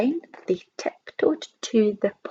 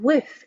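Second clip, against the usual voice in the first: higher bark of a Woof; imitated woof; 5 semitones higher, 3 dB louder than usual. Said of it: "said the dog".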